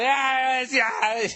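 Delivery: mock drunk interrupting; imitating a drunk. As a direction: barge in like a wasted stranger. Drunk barging into conversation.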